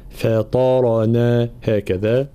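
ordinary talk, nothing marked

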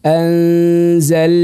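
very slow anzal, landing on L; held lam in anzal. Shown as a demonstration. Teacher demonstrating the entry into lam, L held. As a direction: stretch the word and enter the L. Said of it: "دخلت باللام".

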